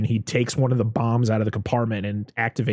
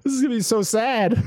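much higher, half-laughing lament; mock dismay; 12 semitones higher than usual. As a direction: groan it out with a laugh. Dreading the sad ending.

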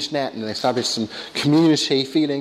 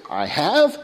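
neurotic ant; 3 semitones higher than usual. Acted. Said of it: "Woody Allen plays this neurotic ant".

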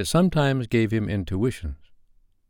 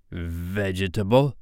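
slow, drawn-out question; measured, questioning pirate captain; probing captain; 3 dB quieter, slower than usual. A narrator's reading. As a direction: stretch the guess out carefully. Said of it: "asked Hook".

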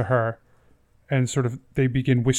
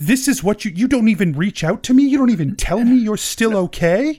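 hurt, rising accusation; betrayed lover rising in outrage; angry; 4 semitones higher, 4 dB louder than usual. Acted. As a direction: scold him, building to a near shout. Lady of Knives confronts lost love.

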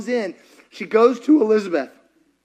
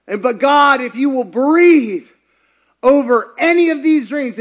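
loud, high preaching cry to God; impassioned prayer; 5 semitones higher, 7 dB louder than usual. Real, not acted. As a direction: cry out in surrender, stretching the words. Prays at full force.

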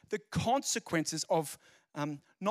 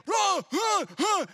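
high, loud shouted warning; yelling warning; 14 semitones higher, 7 dB louder, much slower than usual. Retold or imitated. Scottish teacher warning beachgoers, loud high shout. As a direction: yell it like a tsunami is coming.

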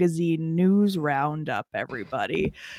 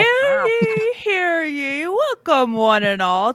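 high ringing herald's proclamation; town crier; 6 semitones higher than usual. Acted. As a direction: call it out like a medieval crier. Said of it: "that was the big fun intro I had planned".

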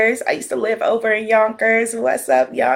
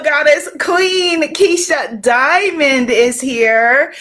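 ordinary talk, nothing marked

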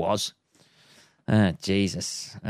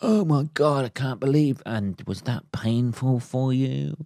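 mock Oprah empathy; 3 semitones higher than usual. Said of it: "she's always fucking".

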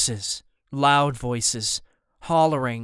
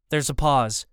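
ordinary delivery, nothing marked

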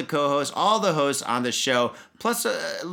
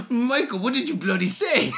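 mock British outrage; 8 semitones higher than usual.

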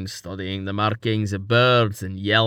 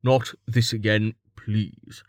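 plain reading voice

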